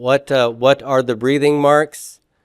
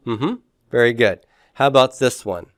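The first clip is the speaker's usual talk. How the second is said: ordinary talk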